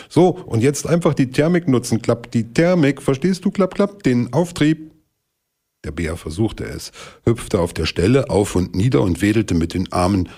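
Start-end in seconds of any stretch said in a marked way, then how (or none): 0.1-4.9 higher, sing-song stork voice calling back. Acted. Stork calling to the bear while flying off, higher and airy. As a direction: call down breezily like a know-it-all bird. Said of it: "Schon im Davonfliegen rief er".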